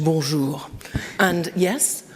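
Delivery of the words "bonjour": "bonjour" said as a native French word; French pronunciation. Real, not acted. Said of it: "in my other language".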